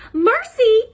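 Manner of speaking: high, loud sing-song call to the pig. A narrator's reading. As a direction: call her in from across the house. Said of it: "Missus Watson called".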